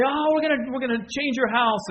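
high, loud imitation of an excited team. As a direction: gush like you're bringing huge news. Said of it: "And they're so excited".

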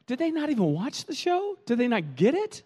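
high, teasing disbelief; mock incredulous. Couple joking about foolish choices, high and mocking. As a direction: tease the people who should know better. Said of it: "we'll say".

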